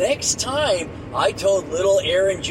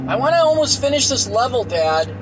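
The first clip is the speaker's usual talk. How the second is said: put-on higher, fast pleading kid; whiny kid; 7 semitones higher, 4 dB louder than usual. Kid pleading with dad to finish the level. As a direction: beg dad for more time.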